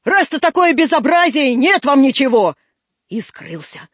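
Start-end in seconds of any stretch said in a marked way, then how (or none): 0.0-2.6 raised, loud reprimand; angry shouting. Acted. One-eyed man refuses unruly boys, angry shouting. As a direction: dismiss them in a loud huff. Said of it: "крикнул кривой человек".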